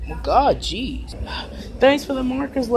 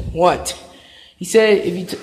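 normal talking voice

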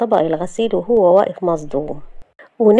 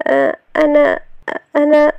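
high, faltering start; hesitant; 8 semitones higher, 3 dB louder than usual. Acted. Shahd about to ask Youssef a favour, hesitant. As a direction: hesitate and repeat, nervous, pitched up.

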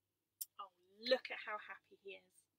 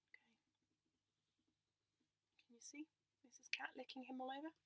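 ordinary delivery, nothing marked

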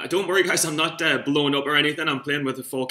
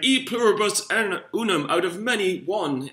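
higher, slowed, grand speech delivery; mock presidential preaching; 6 semitones higher than usual. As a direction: deliver it like a solemn campaign motto. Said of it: "preaching all that shit".

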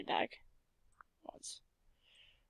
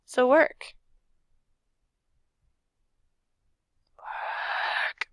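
pleading then yelling; 5 semitones higher, 8 dB louder, much slower than usual. Real, not acted. Game not working, pleading.

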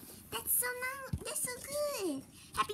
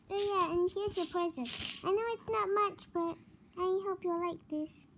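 ordinary talk, nothing marked

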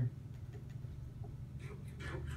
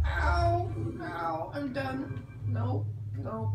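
high exasperated yelps; 5 dB quieter, much slower than usual. Real, not acted. Hurt by ghast, giving up.

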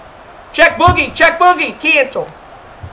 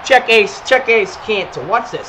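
ordinary talk

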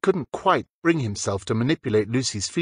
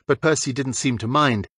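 usual speaking voice

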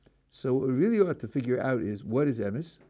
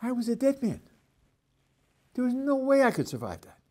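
much higher, amazed voice of a man saved; breathless survivor; 8 semitones higher than usual. Acted. Rescued driver reliving the crash, higher and excited. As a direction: tell it like you just escaped death. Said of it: "One is".

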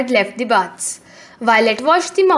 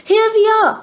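high, louder happy cry; excited cry; 9 semitones higher, 4 dB louder, faster than usual. A narrator's reading. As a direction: burst in calling out with joy. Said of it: "cried the Thea sisters".

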